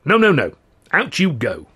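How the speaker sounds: high, loud insistent refusal; loud no-no-no, pushing him out. Acted. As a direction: shoo him out firmly. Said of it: "the head waiter insisted".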